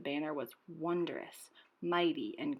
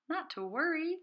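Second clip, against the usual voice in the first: chirpy bird; 10 semitones higher than usual. A narrator's reading. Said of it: "chirped the bird".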